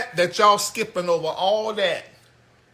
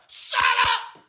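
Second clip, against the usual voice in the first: exasperated shout. Retold or imitated. Yelling back at the crowd.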